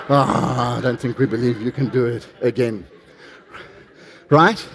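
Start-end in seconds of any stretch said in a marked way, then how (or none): 0.1-2.8 low, soft backing down; sheepishly withdraw the crowd's support; reluctant crowd. Acted.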